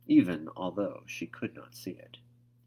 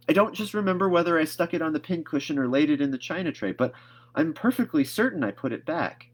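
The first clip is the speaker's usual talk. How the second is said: higher and quick, eager; insistent Anne; 9 semitones higher, 6 dB louder than usual. A narrator's reading. Anne insisting to Marilla she returned it, fast. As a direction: insist in a rush.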